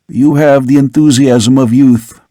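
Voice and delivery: plain reading voice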